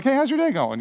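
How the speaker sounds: high, bright mock hello to a stranger; friendly greeting. Preacher imitating his hello to a stranger, high and friendly. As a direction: chirp a cheerful hello.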